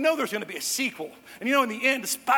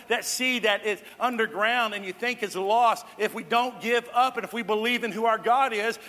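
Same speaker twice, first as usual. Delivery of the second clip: ordinary talk, nothing marked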